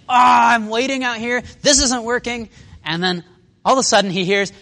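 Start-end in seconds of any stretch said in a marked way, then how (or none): 0.1-2.5 loud, high exasperated complaint; hammed-up fed-up fisherman; frustrated, annoyed. Peter pulling empty nets, acting frustrated and annoyed. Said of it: "they're frustrated and annoyed".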